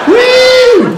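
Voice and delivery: high, loud whoop like a cool whistle. Retold or imitated. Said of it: "that cool whistle".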